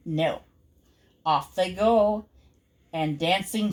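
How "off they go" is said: usual speaking voice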